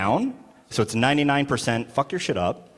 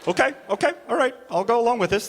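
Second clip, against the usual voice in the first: high, uneasy acted agreement; nervous compliance; 9 semitones higher, faster than usual. Comic going along with terrifying strangers, nervous agreement. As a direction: give in nervously, pitched up. Timid reply to scary partygoers.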